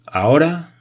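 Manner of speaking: curt. A narrator's reading. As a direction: cut in sharply. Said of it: "dijo Nicol en tono cortante". (tono cortante)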